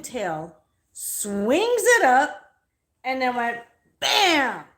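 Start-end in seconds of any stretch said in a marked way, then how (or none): 0.9-2.5 drawn-out word swooping up high and loud; big storytelling swoop; acting out the swing. Miming the snake being swung up. 4.0-4.6 shouted "bam" falling from high; storyteller's loud crash imitation; loud impact bam. Imitates snake hitting the rock.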